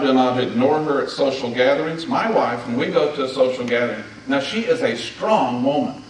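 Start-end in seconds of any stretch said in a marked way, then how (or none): none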